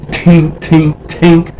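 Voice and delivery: high tapping tink tink vocal imitation; mock tapping sound. Tapping up at the dog upstairs, mock tink sounds. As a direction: mimic a mop tapping the ceiling.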